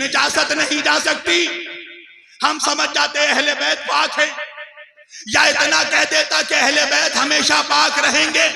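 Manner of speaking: loud, high sermon climax; full-force preaching. Real, not acted. Preacher to congregation on the purity of Ahle Bait, full-force shout. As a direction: thunder it out to the congregation.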